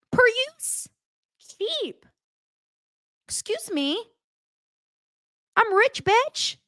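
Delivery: high, snooty rich-lady parody voice; offended millionaire, hammed-up and high-pitched; mock Bronwyn. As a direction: ham up a spoiled rich snob, way up high. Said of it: "And now Bronwyn's like".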